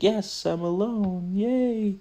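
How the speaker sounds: high, slow gleeful cheer rising into a loud squeal. Retold or imitated. Lola as the taxi leaves, cheering high and gleeful. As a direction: imitate a teen thrilled to be alone. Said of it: "Lindsay Lohan's like".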